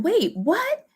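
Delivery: very high, startled question; surprised. Retold or imitated. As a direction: snap round in shock. Ladies react in surprise.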